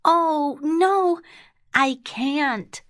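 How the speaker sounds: put-on high little girl voice; high-pitched storybook girl protesting. A narrator's reading. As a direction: refuse in a high, worried child's voice.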